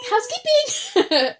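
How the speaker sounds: high, drawn-out sing-song call; housekeeping call. Retold or imitated. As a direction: join in with a slow, high-pitched housekeeping call. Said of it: "I joined in".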